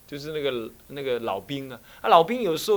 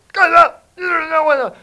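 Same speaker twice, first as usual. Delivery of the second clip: very loud, high, slow bellow; thundering shout at the two youths; furious roar; 14 semitones higher, 13 dB louder, slower than usual. Retold or imitated. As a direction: roar to scare off the thugs. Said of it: "对那个两个青少年大吼".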